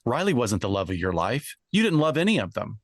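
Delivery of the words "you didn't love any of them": pressing, quick accusing line; acted detective accusation. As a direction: confront the suspect hard. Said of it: "We should act out a scene".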